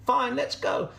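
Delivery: high goat voice, brisk assent; agreeable goat. Acted.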